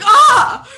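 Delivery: loud, high mock yelp; mock scream. Teller acts out being scolded by cousins, mock scream. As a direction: yelp as if caught out.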